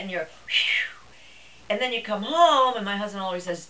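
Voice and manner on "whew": breathy "whew" whistling past; overwhelmed exhaled whoosh; imitated whoosh. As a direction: blow out a long whew. Mimics seminar overload rushing past.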